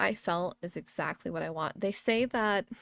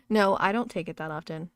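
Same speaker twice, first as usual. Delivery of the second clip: ordinary talk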